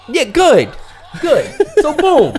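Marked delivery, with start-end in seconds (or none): 0.1-0.7 dismissive coach. Retold or imitated. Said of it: "he's giving me no feedback". 1.2-1.5 dismissive coach. Retold or imitated. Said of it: "he's giving me no feedback".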